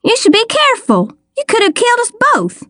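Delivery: high-pitched telling-off; acted reproach after a near miss; indignant scolding. A narrator's reading.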